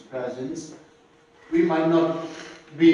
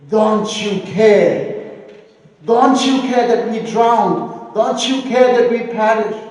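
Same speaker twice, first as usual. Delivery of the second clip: loud, high, desperate cry for help; frantic disciples shouting at a sleeping master; panicked shout; 7 semitones higher, 9 dB louder than usual. Retold or imitated. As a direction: yell it like men about to drown. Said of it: "I love the tone when they shout to Jesus".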